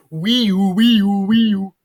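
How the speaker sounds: high, loud wailing alarm; imitated siren. A narrator's reading. Comic narrator signals the hero's escape, siren noise.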